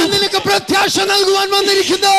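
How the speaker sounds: fervent interpreted preaching. Acted. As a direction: echo the preacher's fire. Interpreter matches preacher's fervor. (ആവേശത്തോടെ ഉച്ചത്തിലുള്ള പ്രസംഗ വിവർത്തനം)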